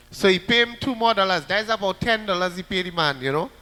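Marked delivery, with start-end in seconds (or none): none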